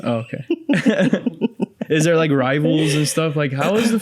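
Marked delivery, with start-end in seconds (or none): 0.5-1.8 high-pitched amused laugh; real, spontaneous high laugh. Real, not acted. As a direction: laugh at a blunt answer. Host laughs at her blunt answer.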